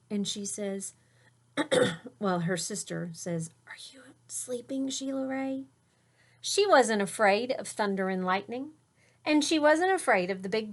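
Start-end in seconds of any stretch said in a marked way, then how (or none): 3.7-5.6 whisper. Acted. Sister whispering at night.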